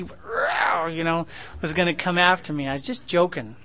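ordinary delivery

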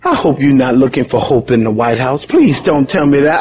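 ordinary talk

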